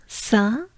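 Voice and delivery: "Saint" with a nasal un vowel and no final t; "Saint" sounded through the nose, sa(n). Shown as a demonstration. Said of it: "un, un, un".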